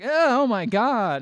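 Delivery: high, loud, slow horrified oh my God; hammed-up crowd shock; mock shocked gasp. Crowd reacting in retold scene.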